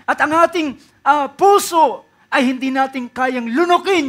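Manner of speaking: preacher urging believers about doubt; preaching at full force. Acted. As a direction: press the point hard on the flock. Preacher exhorts congregation.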